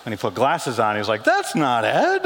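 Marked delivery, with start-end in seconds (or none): 1.2-2.2 very slow, higher reveal. Retold or imitated. Prince Harry correcting the fan, slow and high. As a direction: drag it out like a punchline.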